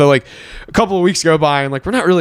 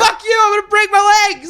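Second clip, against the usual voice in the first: high shout. Acted. Shouting from window in skit.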